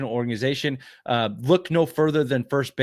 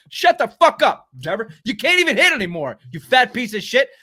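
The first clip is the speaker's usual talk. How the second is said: angry rant; 8 semitones higher, 5 dB louder than usual. Real, not acted. Ranting at the absent player.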